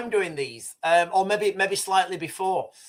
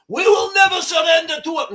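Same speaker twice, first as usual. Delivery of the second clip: badly faked Iranian accent, high and loud; attempted Iranian accent; 9 semitones higher, 6 dB louder than usual. Streamer mocks Iranian military tough talk, high shouted fake Iranian accent. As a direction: shout it defiantly in a bad mock Iranian accent. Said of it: "I can't do an Iranian accent".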